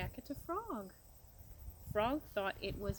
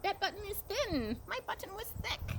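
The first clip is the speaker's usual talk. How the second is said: much higher, mock shout; 11 semitones higher than usual. A narrator's reading. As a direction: keep shouting up high in storybook frustration. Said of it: "shouted Toad".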